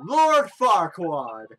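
slow, loud self-announcement; pompous mayor. Acted. Mayor introducing himself to an adventurer, slow and grandiose. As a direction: stretch the name out grandly. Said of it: "I'm the mayor of this town".

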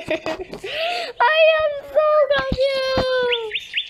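high, slow, crying whine; whiny confused wail. Real, not acted. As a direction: stretch it out in a fake wail of confusion. Baffled by the game.

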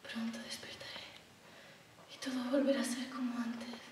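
hushed, halting half-whisper; soft, drifting whisper; whispered self-soothing. Acted. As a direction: murmur it to yourself with long pauses. Reassures herself, alone in fear.

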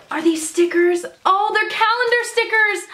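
high-pitched, delighted surprise; light up with excitement, voice climbing; excited discovery. Real, not acted. Vlogger discovering calendar stickers in a gift, delighted surprise.

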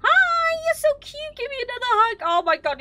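very high, loud squeal; hammed-up high-pitched fawning; mock gushing. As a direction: squeal it like greeting an adored friend. Gushing at a game character.

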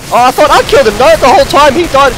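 high, loud, fast complaint; exasperated high yelling; frantic outburst. Real, not acted. Frustrated over a kill.

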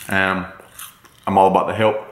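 chewy words through food; talking while chewing. Real, not acted. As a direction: mouth partly full. Seen 1: bites seaweed at 1.5, talks chewing, hand covers mouth later.

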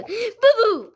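a high, loud "Boo boo" called out; loud playful call. Real, not acted. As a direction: shout it out gleefully. Child calling out playfully.

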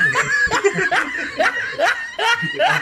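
cracking up, voice jumping high; high burst of laughter. Real, not acted. Punchline of own joke. (malakas at mataas na tawa)

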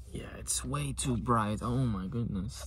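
normal talking voice

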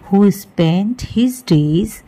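plain reading voice

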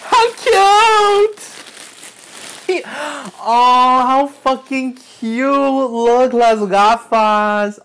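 loud, high, drawn-out cooing; thrilled squeal. Real, not acted. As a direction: squeal with delight at a gift. Thrilled at gift in box.

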